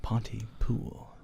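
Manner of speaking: breathy hushed whisper, slow; eerie slow whisper. Acted. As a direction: hiss the title like a secret. Film title whispered.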